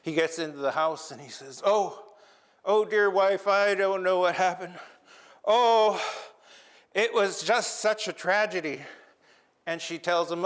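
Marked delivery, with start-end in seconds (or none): 1.7-8.8 higher, louder, theatrical wailing; hammed-up lament. Raven wails to his wife about his lost eye, hammed-up lament. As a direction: overplay the grief to his wife.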